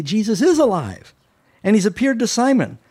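high, loud, bursting with joy; excited news. Retold or imitated. Disciples rushing into the upper room with news, excited and loud. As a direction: shout the good news up high.

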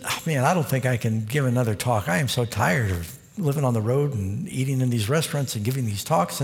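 ordinary talk

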